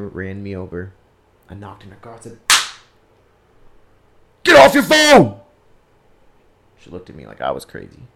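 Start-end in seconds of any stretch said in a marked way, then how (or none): 4.4-5.4 loud, high shouted order; retold angry yell. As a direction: re-enact bellowing at a careless driver. Re-enacts yelling at careless driver.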